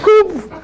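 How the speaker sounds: very high quick "whoop"; a high swoop for slipping away fast; imitated whoosh exit. Imitates darting out the door.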